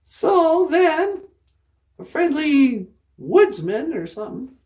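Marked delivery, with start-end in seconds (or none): none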